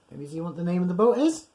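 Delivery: ordinary delivery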